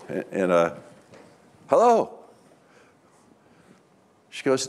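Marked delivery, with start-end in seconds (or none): 1.7-2.2 high-pitched mock "anyone there?" call; playfully reclaim a distracted partner's attention; pitched-up, pointed greeting. Trying to get wife's attention.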